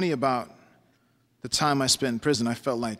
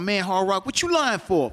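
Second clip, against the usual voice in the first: much higher mocking call down the hallway; yelling down the hall; 8 semitones higher, 5 dB louder than usual. Teen inmate heckling Hard Rock from down the hall, yelling. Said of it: "An adolescent down the hall yells out".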